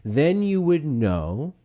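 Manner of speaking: real nice and slow, every word drawn out; patronizing, word-by-word delivery; exaggerated slow speech. Shown as a demonstration. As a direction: spell it out condescendingly. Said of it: "say it real nice and slow".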